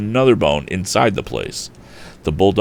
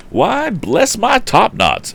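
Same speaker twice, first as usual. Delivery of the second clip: put-on high-pitched, fussy eccentric old gentleman exclaiming; excitable Mr Damon; slower than usual. A narrator's reading. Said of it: "exclaimed the odd gentleman".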